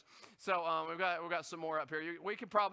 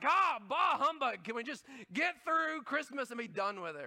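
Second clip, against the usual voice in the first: mock Scrooge grumble; 6 semitones higher, 5 dB louder than usual. Fed-up shopper, Scrooge line.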